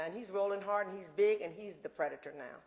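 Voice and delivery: ordinary talk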